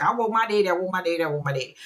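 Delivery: imitated kid's repeated begging; lightly voiced whining little girl; pleading child. Child begging for her daddy.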